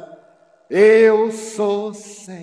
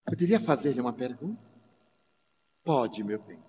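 hushed tender dialogue; 10 semitones lower, 7 dB quieter than usual. Retold or imitated. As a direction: murmur it gently, close and intimate. Mother and daughter dialogue voiced softly. (diálogo sussurrado e emocionado)

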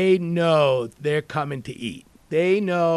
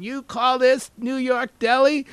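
incredulous customer; 9 semitones higher, 4 dB louder than usual. Retold or imitated. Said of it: "I have these people going".

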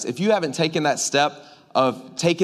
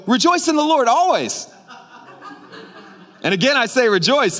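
preachy friend; 4 semitones higher than usual. Retold or imitated. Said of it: "that friend that's, like, super Christ".

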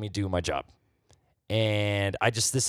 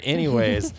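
ordinary talk

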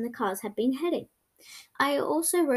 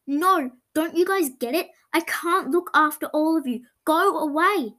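upset pleading; 5 semitones higher, 5 dB louder than usual. A narrator's reading. Chasing the dogs away, upset.

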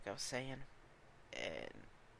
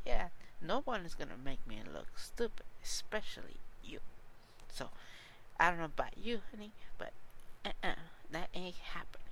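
put-on sassy ex-girlfriend; 3 semitones higher than usual. Podcaster mimics ex defending herself, sassy and higher-pitched. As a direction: imitate her sassy, emphatic put-down.

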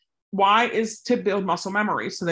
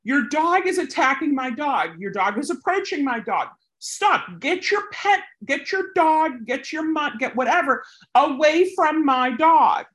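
yelling; 8 semitones higher than usual. Shown as a demonstration. Demonstrating to viewers how to yell at an owner, high shout. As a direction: shout to stop the charging dog. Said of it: "you can say".

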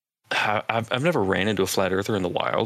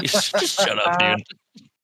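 much higher, louder mock scolding voice; mocking retort; 11 semitones higher, 4 dB louder, slower than usual. Mocking Ventura's guest's reply, high loud put-down. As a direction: scoff at him in a squeaky put-down. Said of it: "it's like".

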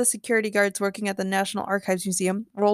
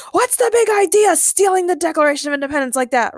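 high, loud scolding; outraged scream; 7 semitones higher, 6 dB louder than usual. A narrator's reading. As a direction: yell the accusation at him. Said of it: "Ray screamed".

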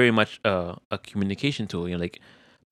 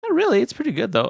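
much higher, unbothered voice; high wife voice; 12 semitones higher, faster than usual. Retold or imitated. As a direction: shrug it off in a high voice. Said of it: "She's like".

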